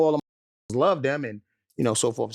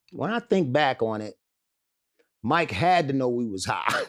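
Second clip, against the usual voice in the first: usual speaking voice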